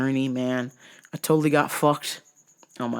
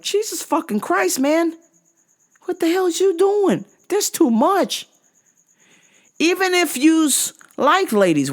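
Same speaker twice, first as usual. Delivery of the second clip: exasperated high outburst; 12 semitones higher than usual. Retold or imitated. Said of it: "And I'm like".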